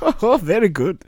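put-on high-pitched West German accent. West German guy approving in the background, high German-accented voice. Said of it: "West German guy is like".

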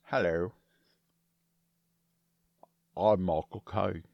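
deep, slow Cockney Michael Caine; low, slow Cockney with a full mouth; Michael Caine impression. Shown as a demonstration. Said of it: "It was slower and deeper than the clip".

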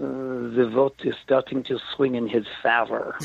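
hammed-up French accent, last word bent out of shape; a comic French inspector accent going cuckoo on favor; mock French accent. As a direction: overdo the French and warp the final word favor. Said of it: "really went cuckoo on the last word".

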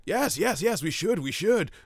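high, excited egging on; hammed-up priest urging on the fight; eager mock cheering. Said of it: "He's like".

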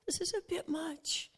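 barely-voiced prayer to the Father; whispered prayer. Jesus in Gethsemane praying to the Father, whispered. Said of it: "He's like".

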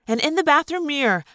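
plain reading voice

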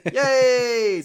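bright cheer with a laugh; excited yay. Real, not acted. Excited cheer at million yen.

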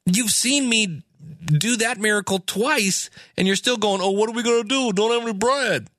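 high, fast, exasperated rant; Jesus, fed up with his disciples, ranting high and fast; frustrated Jesus. Retold or imitated. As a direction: vent at friends who just don't get it. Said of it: "He's getting a little frustrated".

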